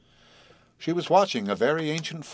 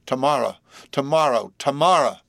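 each cry louder than the last; rising delirious cries; 3 semitones higher, 6 dB louder than usual. Acted. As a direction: build the calls into a scream. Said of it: "delirious, unrestrained passion".

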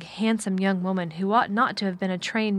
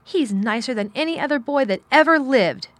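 high, loud, heated praise; indignant and emphatic; fiery defence; 5 semitones higher, 6 dB louder than usual. A narrator's reading. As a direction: defend him passionately, pitched up. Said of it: "Mary's eyes flashed fire".

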